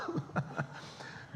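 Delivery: breathy high laugh at himself; airy laugh. Real, not acted. Preacher laughing at his own slip in Egypt, airy laugh. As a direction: laugh it off, embarrassed.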